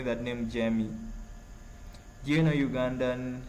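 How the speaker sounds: ordinary talk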